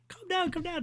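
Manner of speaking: very high imitated hushing; mock calming voices. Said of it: "They're like".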